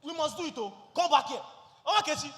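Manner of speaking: very loud, high-pitched shouting; angry insistence. Acted. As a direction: yell your decision after her as she leaves. Husband shouts after departing wife.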